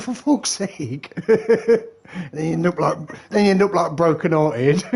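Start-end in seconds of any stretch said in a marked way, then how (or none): 0.0-1.1 high, slow exasperated groan of a line; slow fed-up curse. Retold or imitated. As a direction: drag it out, fed up. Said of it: "I'm like".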